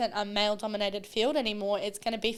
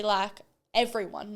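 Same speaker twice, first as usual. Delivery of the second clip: ordinary delivery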